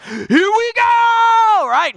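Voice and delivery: very high, slow, drawn-out shout; a wild, stretched-out war whoop; reckless battle cry. Retold or imitated. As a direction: charge in yelling at full voice. Charging in recklessly.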